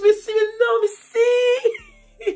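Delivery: high, loud half-laughing half-crying; teen girl in a giggly panic telling her friend; panicked squeal. Retold or imitated. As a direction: squeal it out, overwhelmed and giddy.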